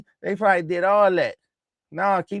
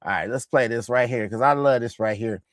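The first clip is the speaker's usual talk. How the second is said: ordinary talk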